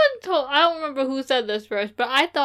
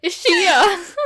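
laughing; 8 semitones higher, 6 dB louder than usual. Real, not acted. Laughing at the joke.

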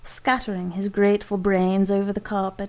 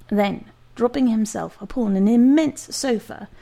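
usual speaking voice

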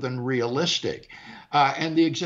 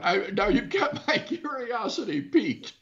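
laughing; 6 semitones higher than usual. Real, not acted. Amused at the next clip.

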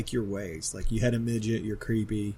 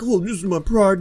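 raised, louder mock-proud voice; 7 semitones higher, 5 dB louder than usual. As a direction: pitch it up and puff out the pride. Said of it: "Fucking [name] was like".

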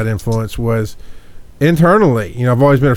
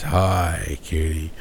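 imitating a suddenly dropped, solemn greeting; mock serious voice; 6 semitones lower, 8 dB quieter, much slower than usual. Teasing the phone greeting by imitation, mock serious low voice. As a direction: go lower and softer, mimicking that serious hello. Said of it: "the voice dropped and it became so serious".

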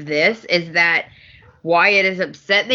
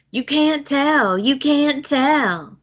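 higher, slow, pleading; pleading Carter; 7 semitones higher, slower than usual. Retold or imitated. Carter begging to keep the secret, higher and slow. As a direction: beg them not to tell. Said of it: "Carter picks right up".

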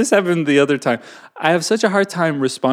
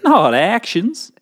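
slow, higher Southern drawl; Southern accent; 6 semitones higher, slower than usual. Pretend. Passing on the next rule as homespun advice, Southern accent. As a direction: stretch the words out like a Southerner. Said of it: "do it in a Southern accent".

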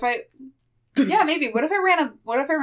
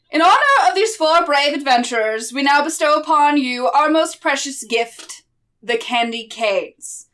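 booming town mayor proclaiming; jolly mayor; 4 semitones higher, 6 dB louder than usual. Acted. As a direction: proclaim it to a cheering crowd. Mayor addresses crowd.

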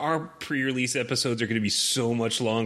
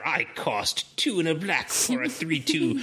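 cartoon villain voice; 5 semitones higher than usual. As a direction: gloat like a cartoon baddie. Villain voice after cartoon villain remark.

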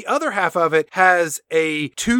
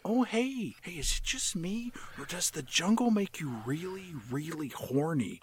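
Owen Wilson; 13 dB quieter than usual. Acted. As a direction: murmur it like a laid-back movie star hitting on a crewmate. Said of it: "Owen Wilson, And Wilson says".

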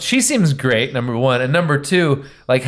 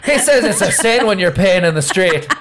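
high, hammed-up Irish priest; mock-Irish sermonizing with a laugh in it; Irish accent; 6 semitones higher than usual. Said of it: "Did he have an Irish accent?".

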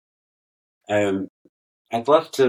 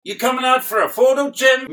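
much higher, louder [name] call through the door; 4 dB louder than usual. Retold or imitated. [name] calling Jim out mid-toilet, high call. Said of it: "[name]'s at the door going".

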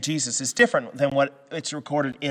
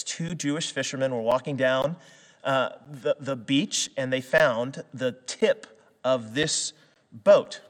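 normal talking voice

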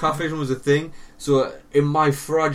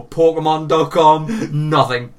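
emphatic correction; 3 semitones higher, 7 dB louder, much slower than usual. Real, not acted.

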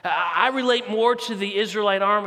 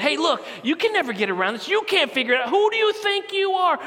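higher, laughing taunt climbing to a high squeak; mocking fear giant; 9 semitones higher than usual. The fear giant mocks the listener, higher and laughing. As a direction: jeer at them that they can't escape. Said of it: "he's saying".

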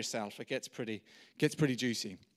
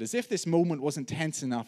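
normal talking voice

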